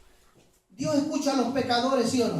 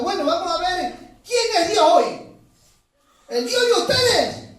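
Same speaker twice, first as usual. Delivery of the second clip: loud, high preacher voicing a prophet's dare; bold challenge; 7 semitones higher, 5 dB louder than usual. Prophet challenging rival prophets to a contest of gods, bold and loud. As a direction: throw down the gauntlet, loud and high.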